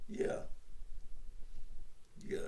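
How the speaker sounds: ordinary talk, nothing marked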